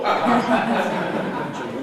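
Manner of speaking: hearty laugh; loud laugh. Real, not acted. Purple pants ruler joke.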